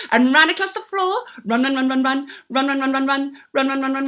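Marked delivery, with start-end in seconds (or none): none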